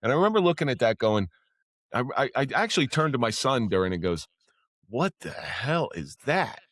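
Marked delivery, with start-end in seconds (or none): 4.9-6.6 slow, drawn-out incredulous question; retold bafflement. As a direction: stretch each word in mock disbelief. Retelling baffled reaction in church.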